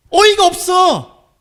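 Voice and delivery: slow, high, drawn-out disbelief; dumbfounded. Pretend. Pastor as Jesus at the disciples, slow disbelief. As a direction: stretch it out like you're speechless. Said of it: "라고 주님 말씀하시는 거예요".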